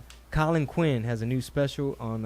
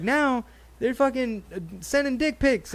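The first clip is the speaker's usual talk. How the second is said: lightly voiced comedian, pitched up, with a laugh; imitating Colin Quinn; 11 semitones higher than usual. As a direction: poke fun like a comic closing a bit. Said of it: "He's like".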